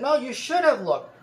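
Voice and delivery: high, loud insisting; insistent scolding. Retold or imitated. Lady insisting again, quoted.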